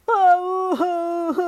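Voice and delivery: high, loud roaring sob; sobbing lion roar. Acted. Lion sobs and roars in answer to the little bear. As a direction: roar through tears.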